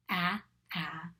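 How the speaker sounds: two separate ah sounds, as in apple; short A sound. Shown as a demonstration. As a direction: sound out ah, ah like apple's first vowel. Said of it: "That is the short sound".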